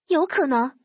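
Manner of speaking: plain reading voice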